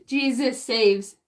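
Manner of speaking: usual speaking voice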